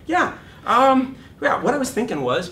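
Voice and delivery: high, loud, breezy pitch voice; high breezy salesman's tone; mock confident pitch. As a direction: play it smooth for the executive. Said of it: "And I went".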